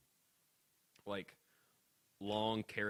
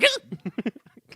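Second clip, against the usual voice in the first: loud, high mock grunt; imitated weird yelp; 13 semitones higher, 10 dB louder than usual. Demonstrating the country sentence-ender, loud high yelp. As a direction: squeak out a weird country noise. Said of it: "kind of a noise".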